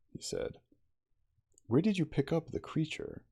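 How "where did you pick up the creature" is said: probing. A narrator's reading. Probing question, raised voice.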